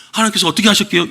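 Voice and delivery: normal talking voice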